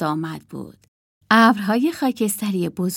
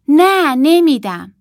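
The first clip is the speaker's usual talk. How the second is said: loud, slow stubborn no; little Marta refusing her sister, loud and stubborn; defiant refusal; 7 semitones higher, 10 dB louder, slower than usual. Acted. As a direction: dig in and refuse.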